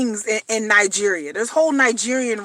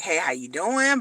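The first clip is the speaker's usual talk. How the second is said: smooth scammer; 8 semitones lower, 8 dB quieter than usual. Retold or imitated. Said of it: "they'll talk to you and be like".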